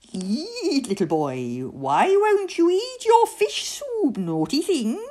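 high, swooping wicked fairy; villainous singsong taunt; taunting bad fairy. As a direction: tease and scold with relish. Bad fairy taunts the tied boy.

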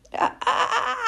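high, halting gasps for the word; breathless little owl; winded stammer. Acted. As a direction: stutter while catching your breath. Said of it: "when he had got his breath back".

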